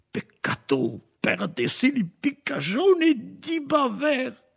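high, drawn-out lament, slowly; theatrical cry of regret, pitched well up; mock regret. A narrator's reading. Leclerc, about to hang, laments missing a hanging, mock regret. Hanged man's thought acted out.

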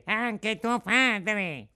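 drawn-out gloating threat; slow menacing echo. Acted. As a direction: stretch every word, gloating. Second bandit echoes threat. (ripetuto lento e minaccioso)

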